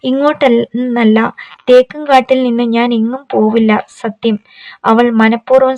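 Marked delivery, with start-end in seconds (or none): none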